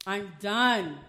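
DONE hit loud and drawn out; retold ultimatum, landing hard on DONE; firm repeated ultimatum. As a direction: put your foot down on DONE. Repeats ultimatum more forcefully.

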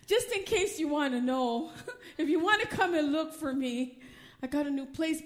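retold hint broken by chuckles; playful, half-laughing aside; coy, laughing hint. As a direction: drop the hint through a little laugh.

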